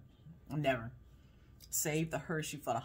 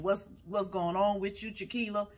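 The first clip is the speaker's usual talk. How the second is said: talking stomach; 4 semitones higher, 6 dB louder than usual. Pretend. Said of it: "Got my stomach saying".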